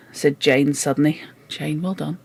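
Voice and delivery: plain reading voice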